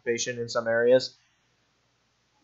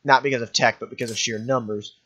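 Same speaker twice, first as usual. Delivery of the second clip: normal talking voice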